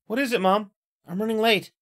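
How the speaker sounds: put-on girl's voice. Daughter answering her mother in the morning, hurried girl voice. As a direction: answer mom a little rushed.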